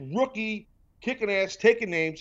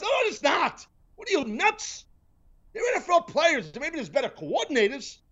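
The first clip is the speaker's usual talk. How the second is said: high shouting rant; flipping out; 10 semitones higher than usual. Real, not acted. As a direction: shout it down, exasperated. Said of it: "I'm flipping out over here".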